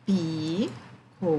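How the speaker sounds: PICOLÉ drawn out very slowly in separate syllables. Shown as a demonstration. Teacher splitting picolé to count its syllables, slow syllable-by-syllable. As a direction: break "Picolé" into pi, co, lé, pausing between them. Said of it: "Quantas sílabas mais a gente tem?".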